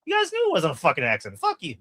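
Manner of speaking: high-pitched mock argument; hammed-up, high quarrelling voice; imitated trash talk. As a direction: fire back as a bitter accuser. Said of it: "she's talking shit".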